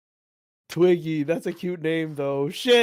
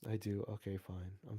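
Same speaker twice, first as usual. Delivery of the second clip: faint grumble; 10 semitones lower, 21 dB quieter than usual. Pretend. Mock surrender, fading.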